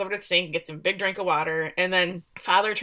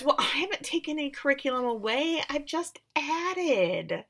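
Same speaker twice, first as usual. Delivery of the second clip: put-on sweetness, pitched up and smug; irritating know-it-all; 8 semitones higher, 4 dB quieter than usual. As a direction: imitate an insufferably self-righteous woman. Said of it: "she's so irritating".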